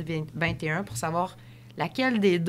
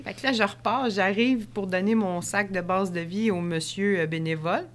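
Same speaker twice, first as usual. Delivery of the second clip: ordinary talk, nothing marked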